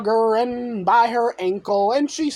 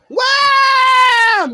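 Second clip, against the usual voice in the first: high, loud burst of one word; the narrator bellowing a crash; loud sound-effect shout; 14 semitones higher, 7 dB louder than usual. Pretend. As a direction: slam it out like a hit.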